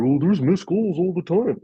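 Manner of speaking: mocking sceptic. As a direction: parody the people who excuse the refs.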